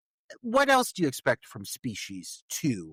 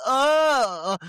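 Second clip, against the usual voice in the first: loud, high, drawn-out mock moan; hammed-up dying groan that sounds orgasmic; sexy death groan; 11 semitones higher, 7 dB louder than usual. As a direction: imitate a sultry last gasp, long and loud. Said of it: "in the sexiest way possible".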